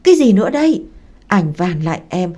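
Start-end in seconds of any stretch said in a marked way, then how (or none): 0.0-0.8 loud, high startled outburst; raised voice, fed up; angry cry out. A narrator's reading. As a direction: shout it out in angry disbelief. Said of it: "Mằn la lên".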